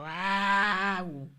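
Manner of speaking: ordinary talk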